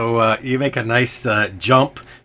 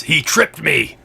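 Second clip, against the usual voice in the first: higher, mildly indignant character voice; accusing victim's role; 6 semitones higher, 4 dB louder, slower than usual. Acted. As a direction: play the fallen man blaming someone. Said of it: "he looks up and he says".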